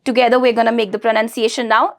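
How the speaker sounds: usual speaking voice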